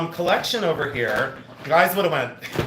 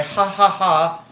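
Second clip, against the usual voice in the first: slow, higher, mock nervous ha-ha; fake nervous laugh; 6 semitones higher, 4 dB louder, much slower than usual. Shown as a demonstration. Mocking nervous YouTubers on camera, slow fake laugh. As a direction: spell out a pretend nervous chuckle. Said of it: "nervous laugh".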